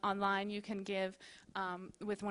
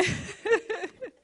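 bright high burst of laughter; a giddy happy giggle; delighted laugh; 10 semitones higher, 4 dB louder than usual. Real, not acted. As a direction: laugh out in pure joy. Gushing over the scripture.

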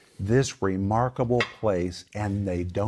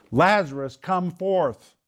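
mildly raised, higher-pitched mock yell; retold yell down; 8 semitones higher, slower than usual. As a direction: call down into a cave, without full force. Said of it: "yelling down".

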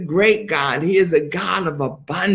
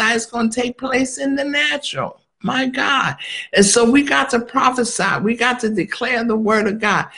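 pitched-up fervent exhortation; rising preaching; 6 semitones higher than usual. Acted. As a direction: lift the sermon into high urgent preaching. Preaching at full force.

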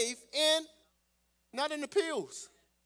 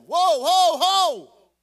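excited whoa; 4 semitones higher, 12 dB louder, much slower than usual. Real, not acted. Preacher exclaims after verse.

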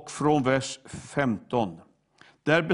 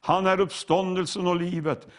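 ordinary talk

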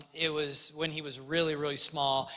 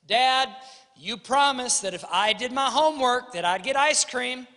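put-on raised, louder pleading kid voice; kid asking for a promise; 5 semitones higher, 5 dB louder than usual. Child reminding dad of his promise, pleading.

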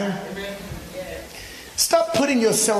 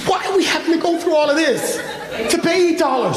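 laughing exasperation; 7 semitones higher, faster than usual. Real, not acted. Exasperated about eight dollars.

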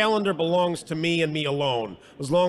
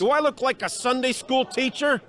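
irritated, pitched-up retort; scornful challenge; 8 semitones higher than usual. Acted. Hard convict to a green young inmate, scornful. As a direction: throw the question back with scorn.

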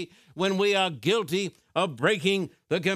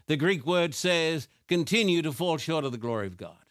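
ordinary talk, nothing marked

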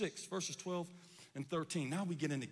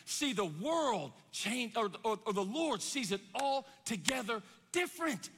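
usual speaking voice

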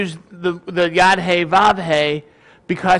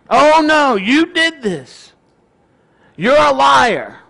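mock accusing; 6 semitones higher, 4 dB louder, slower than usual. Retold accuser calling him liar.

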